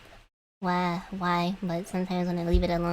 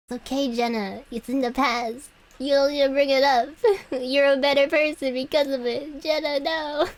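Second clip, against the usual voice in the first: rising, louder half-laughing consolation; mock tearful plea; 7 semitones higher, 5 dB louder than usual. Fan consoling Jenna about leaving, a rising laughing mock plea. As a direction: comfort her, then break into a higher, louder laughing plea.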